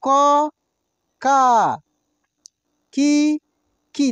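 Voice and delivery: each syllable called out slowly and loud, with pauses; slow drill recitation. Shown as a demonstration. Teacher reciting syllables for students to repeat aloud. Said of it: "same वैसे ही तो हम लोग क का भी पढ़े हैं".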